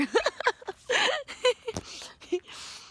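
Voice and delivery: giggling high and bright; sudden giggle; high burst of laughter. Real, not acted. Laughs at own remark.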